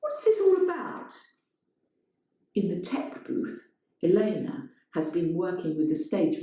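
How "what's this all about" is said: high, raised flustered call. Acted. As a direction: call into the dark theatre. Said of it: "he calls out".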